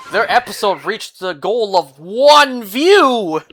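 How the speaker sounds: loud, drawn-out mock triumph; mock hype. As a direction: celebrate it grandly, slow and loud. Mock hype over one view.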